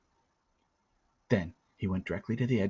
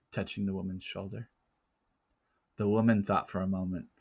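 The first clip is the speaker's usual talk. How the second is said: ordinary delivery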